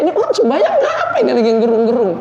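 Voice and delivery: a fast, laughing tease; teasing him through laughter, quickly; laughing remark. Retold or imitated. Preacher mocking his friend for sobbing in prayer, laughing. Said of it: "kata saya".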